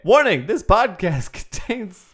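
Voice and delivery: high, loud warning broken by laughter; giggling mock announcer; laughing through it. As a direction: try the warning, cracking up. Imitating the Murray warning.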